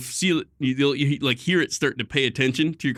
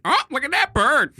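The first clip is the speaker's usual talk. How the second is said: high, parroted coo; squawky parrot echo of a visitor; parrot mimicking a customer; 14 semitones higher, 4 dB louder than usual. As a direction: mimic a talking bird copying a customer. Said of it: "wife would hear in the waiting room".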